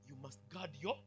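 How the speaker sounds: ordinary talk, nothing marked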